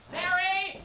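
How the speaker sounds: loud, high shout for Mary; calling out. Acted. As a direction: holler across the room.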